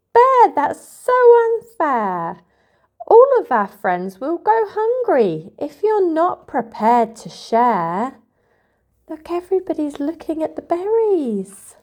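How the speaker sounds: high, bright-eyed bird voice, reproachful; upset little bird. Acted. Bird scolding his best friend, high and upset. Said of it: "Bird was upset with his best friend".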